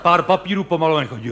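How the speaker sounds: normal talking voice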